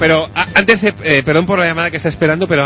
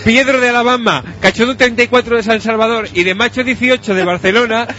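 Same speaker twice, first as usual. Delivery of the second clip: higher, declaimed read-aloud voice; forum post read-out; 5 semitones higher than usual. Acted. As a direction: read each post out like a soap recap. Reads forum posts aloud. (lectura declamada de mensajes del foro)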